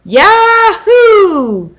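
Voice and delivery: high, loud echoing yell; copied whoop. A narrator's reading. Elisa copying her brother's whoop, high and loud. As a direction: call it back like an echo. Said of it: "Elisa copied him".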